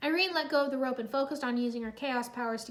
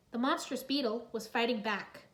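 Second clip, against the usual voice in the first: plain reading voice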